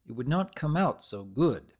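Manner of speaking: ordinary delivery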